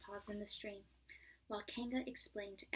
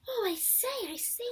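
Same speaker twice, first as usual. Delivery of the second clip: much higher, louder flustered cries; excited squeaks; 12 semitones higher, 8 dB louder than usual. A narrator's reading. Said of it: "making noises".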